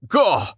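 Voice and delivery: sudden high gasp; squeezed-out exclamation. Acted. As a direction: gasp as if crushed in a hug. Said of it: "he gasped".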